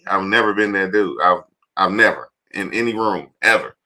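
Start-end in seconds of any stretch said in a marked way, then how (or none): none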